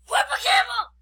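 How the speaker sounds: high-pitched, loud silly yell; playful scream. Pretend. Kid showing off how loud they are, playful scream. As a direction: yell it high, away from the microphone. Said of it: "you have to scream at my microphone".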